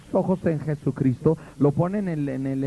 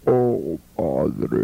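very low hollow mock prayer voice; hammed-up sepulchral prayer; mock voice from beyond the grave; 13 semitones lower than usual. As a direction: intone it like a ghost from the tomb. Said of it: "esos que hacen la voz así".

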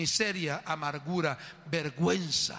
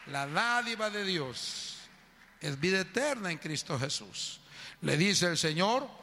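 normal talking voice

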